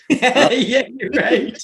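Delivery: laughing through a high yeah, you're right; cracking up while agreeing. Real, not acted. Laughing at his friend's name mix-up, cracking up as he agrees.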